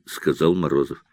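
usual speaking voice

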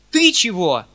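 sharp loud shout. A narrator's reading. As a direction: yell it at the servant. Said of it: "закричал он".

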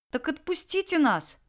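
high, pleading cry; boy imploring the bandit; begging. Acted. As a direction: beg them to let you go. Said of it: "взмолился Кирилл".

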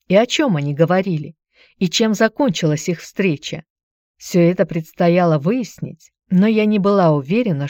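ordinary delivery

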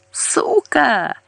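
high, shrill shriek of an insult. A narrator's reading. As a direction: spit it out in a squeal. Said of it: "взвизгнул мужской голос".

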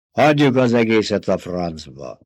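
ordinary delivery, nothing marked

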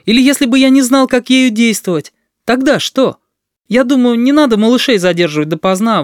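put-on high, ringing boyish speech; impassioned public outburst, high-pitched. A narrator's reading. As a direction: speak up boldly, pitch held high. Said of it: "всё громче и звонче".

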